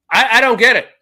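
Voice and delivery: normal talking voice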